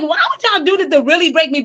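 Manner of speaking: high, loud, incredulous complaint; indignant protest. Retold or imitated. Protesting to God about being broken, high indignant pleading. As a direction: demand an answer, outraged.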